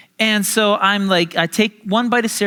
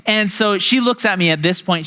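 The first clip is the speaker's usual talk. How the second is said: normal talking voice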